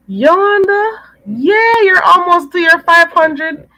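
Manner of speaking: high, loud congratulatory call; excited cheering. Real, not acted. Host cheering Yolanda on toward five hundred, excited. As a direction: cheer her on with delight. Cheering a viewer's subscriber count.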